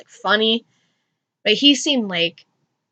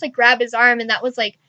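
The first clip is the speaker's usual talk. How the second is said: normal talking voice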